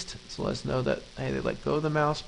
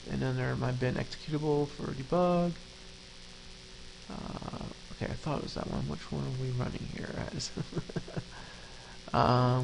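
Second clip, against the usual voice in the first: muttering to self; 8 dB quieter than usual. Real, not acted. Muttering while searching files.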